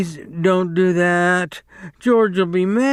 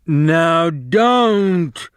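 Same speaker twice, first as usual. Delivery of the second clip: higher, louder angry warning; 6 semitones higher, 5 dB louder than usual. A narrator's reading. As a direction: tell her off, louder. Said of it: "Lenny grew angry".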